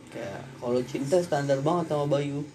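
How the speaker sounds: ordinary talk, nothing marked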